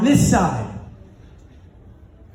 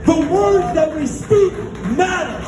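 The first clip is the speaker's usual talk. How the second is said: high, sustained rallying delivery; triumphant climax; 5 semitones higher than usual. Real, not acted. Speaker brings the hundred-mile race home to the audience, triumphant. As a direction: build to the finish line, ringing out each phrase.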